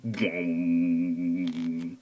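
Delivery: ordinary talk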